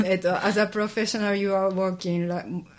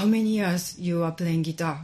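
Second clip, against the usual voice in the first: ordinary talk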